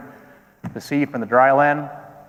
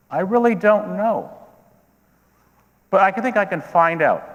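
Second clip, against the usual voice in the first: higher-pitched, held-in reply; controlled temper; 4 semitones higher than usual. Acted. Herod answering the strangers, temper held in check. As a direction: keep a lid on the outrage. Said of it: "But I controlled my temper".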